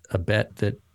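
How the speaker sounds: ordinary talk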